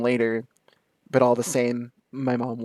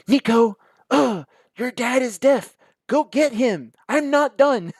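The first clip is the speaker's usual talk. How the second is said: much higher and louder, calling across the house; mom calling out; 12 semitones higher, 7 dB louder than usual. Retold or imitated. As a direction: yell for your son from another room, impatient. Mom calling son to fetch dad.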